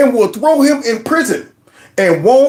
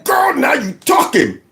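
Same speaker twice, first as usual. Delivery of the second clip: high excited whoop, half laughing; thrilled whoop; 8 semitones higher than usual. Real, not acted. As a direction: cheer her on with a delighted squeal. Thrilled reaction to guest's line.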